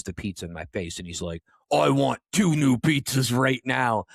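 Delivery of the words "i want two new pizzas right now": slow, punched-out mock customer demand; imitated angry demand. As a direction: play an irate customer, each word drawn out. Angry customer demanding replacement pizzas.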